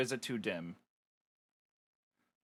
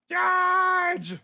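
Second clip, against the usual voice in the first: high loud charging yell; battle cry; 11 dB louder than usual. Acted. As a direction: roar it into battle.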